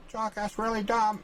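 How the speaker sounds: high scoffing voice of a critic; high mocking critic. Map-maker voices a critic scoffing at him, high.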